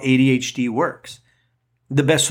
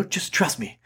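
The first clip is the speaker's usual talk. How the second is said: quiet, coaxing trust me; softer trust me; 3 semitones higher, 6 dB quieter than usual. Pretend. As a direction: murmur it gently. Repeated reassurance, first quiet.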